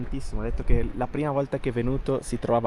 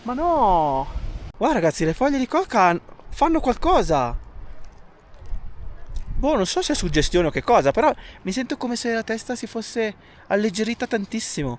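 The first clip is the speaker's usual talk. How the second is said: excited; 6 semitones higher than usual. Real, not acted. Reluctant to leave, feels effect.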